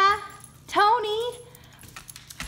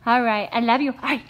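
ordinary talk, nothing marked

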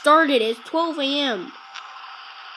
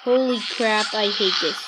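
normal talking voice

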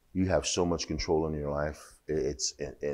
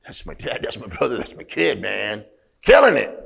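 rising to a high loud Killing it; building proud shout; proud excited cheer; 5 semitones higher, 6 dB louder than usual. Retold or imitated. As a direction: brag like a proud parent in the stands. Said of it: "You'd be like".

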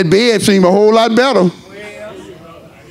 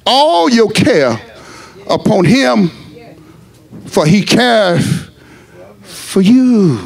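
slow scripture; 3 semitones higher, much slower than usual. Acted. Slow drawn-out scripture reading.

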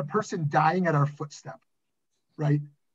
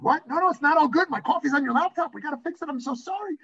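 high-pitched frantic protest, acted out; retold flustered apology; freaking out; 9 semitones higher, 4 dB louder than usual. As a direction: protest in a mock panic, pitch way up. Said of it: "I'm freaking out".

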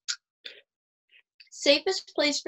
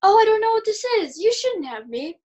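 high, loud gushing; fake surprise; 8 semitones higher, 3 dB louder than usual. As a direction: gasp over the gift.

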